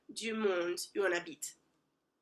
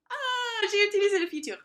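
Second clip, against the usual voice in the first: delighted laughing squeal; 11 semitones higher, 5 dB louder, faster than usual. Real, not acted. Proud of using future tense.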